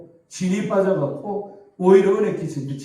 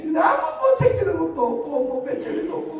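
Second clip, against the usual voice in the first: high-pitched mock whine; child's grumble; 12 semitones higher than usual. Pastor voicing Hanna's reasons for staying, mock whine. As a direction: imitate a kid griping in a high voice.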